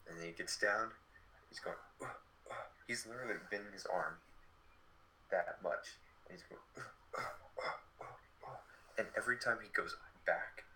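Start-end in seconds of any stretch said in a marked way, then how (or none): 2.0-2.7 short unvoiced effort grunts; imitated push-up grunts. The fifty-eight-year-old doing push-ups for the kids, mock straining grunts. 6.8-8.6 short unvoiced effort grunts; imitated push-up grunts. The fifty-eight-year-old doing push-ups for the kids, mock straining grunts.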